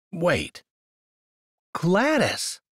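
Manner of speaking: high, disbelieving question; incredulous. A narrator's reading. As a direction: repeat the name in disbelief. Incredulous at the dog's name.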